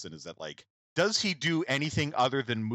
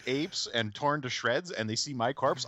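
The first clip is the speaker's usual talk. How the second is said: usual speaking voice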